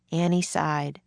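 ordinary delivery, nothing marked

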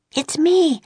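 ordinary delivery, nothing marked

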